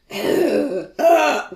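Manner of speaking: loud, high weary groan; exhausted groan. Retold or imitated. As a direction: groan as if barely able to speak. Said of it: "God, you can barely speak".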